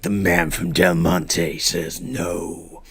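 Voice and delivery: slow, drawn-out ominous advert voice; ad voiceover. Retold or imitated. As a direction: intone it like a grave old TV ad. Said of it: "And it's like".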